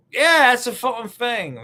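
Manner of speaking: high, loud mock British accent. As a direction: bellow it in exaggerated British. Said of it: "if your accent".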